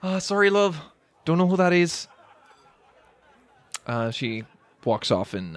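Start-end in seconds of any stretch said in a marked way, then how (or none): 0.0-2.1 "sorry" redone in the server's higher, louder voice; tavern server retake. Acted. Server retakes "sorry" after Canadian slip, high character voice. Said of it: "I went Canadian there".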